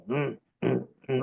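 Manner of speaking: soft musician's grunts, mimicked; imitated little grunts. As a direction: grunt quietly as if keeping your place in the music. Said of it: "little grunts".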